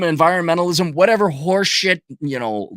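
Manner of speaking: usual speaking voice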